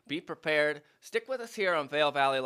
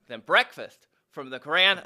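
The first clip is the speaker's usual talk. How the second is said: usual speaking voice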